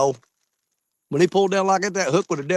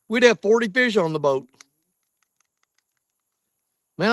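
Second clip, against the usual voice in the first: ordinary talk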